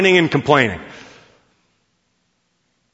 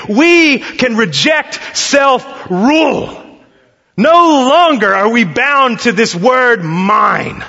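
preaching at full force; 6 semitones higher, slower than usual. Real, not acted. Preacher to congregation, slow rising proclamation. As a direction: drive home the freedom slowly.

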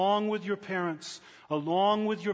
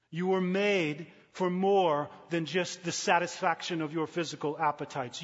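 ordinary talk, nothing marked

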